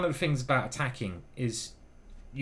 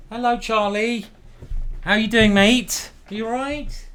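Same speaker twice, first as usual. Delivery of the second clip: cooing pet talk; 10 semitones higher, 9 dB louder, slower than usual. Real, not acted. Greeting his cat Charlie.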